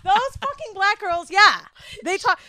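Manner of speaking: high, slow mocking voice. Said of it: "She's gonna be listening to me like".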